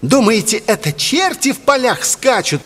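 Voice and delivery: high falsetto harangue; impassioned boy. A narrator's reading. Boy works up the crowd.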